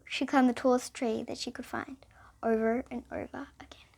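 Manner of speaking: breathy hushed narration; storyteller's hushed whisper. Acted. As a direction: whisper it to the listeners.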